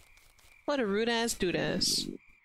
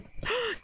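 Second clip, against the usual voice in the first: excited gasp; 13 semitones higher than usual. Real, not acted. Reacts to joining the Defense Guild.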